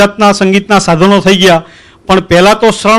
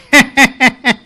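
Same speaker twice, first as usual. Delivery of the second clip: quick laugh; 4 semitones higher than usual. Real, not acted. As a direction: laugh between the lines. Laughing at the joke.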